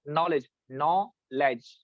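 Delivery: नॉलेज split into two beats; syllable demo. Shown as a demonstration. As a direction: say नॉलेज as नॉ-लेज, each syllable marked. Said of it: "दो दफा हरकत की है".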